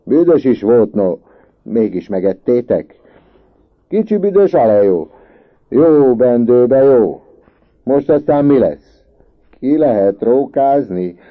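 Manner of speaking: loud, high schoolboy taunts; boisterous mocking shouts; rowdy jeering. A narrator's reading. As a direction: holler it over the uproar. Boys jeer and shout in uproar.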